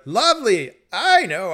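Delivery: high, loud irritated correction; insist, fed up; exasperated correction. Retold or imitated. Correcting him again.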